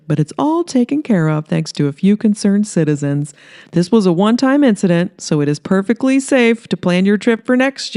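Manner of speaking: a sharky mayor selling his town to the camera; smarmy mayor. Acted.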